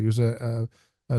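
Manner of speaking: ordinary talk, nothing marked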